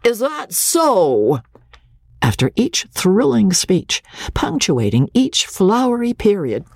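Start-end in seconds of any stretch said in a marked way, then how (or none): 0.0-1.4 put-on slow, higher taunting drunk; drunken drawl. A narrator's reading. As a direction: drag it out mockingly like a drunk heckler. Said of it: "a drawling, drunken voice ... a taunting".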